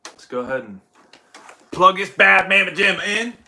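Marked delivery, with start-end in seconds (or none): 1.7-3.4 high, loud playful hype. Pretend. Streamer plugging in the console for viewers, playful hype. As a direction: belt it out with showy gusto.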